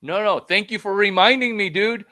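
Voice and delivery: loud, high burst of thanks; mock-thrilled thanks to a reminder; grateful exclamation. People thanking for a reminder, loud high excited voice. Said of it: "people would be like".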